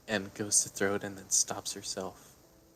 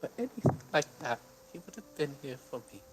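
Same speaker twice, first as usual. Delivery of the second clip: grieving; 7 semitones higher, faster than usual. Acted. Grieving character speaks to herself.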